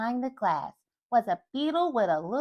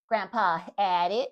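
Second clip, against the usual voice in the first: ordinary delivery, nothing marked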